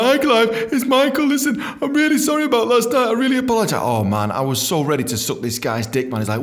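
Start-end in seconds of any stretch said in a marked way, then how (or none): 0.0-3.8 high-pitched mock pleading; grovelling apology. Sacked dentist apologises to boss about last night, high grovelling.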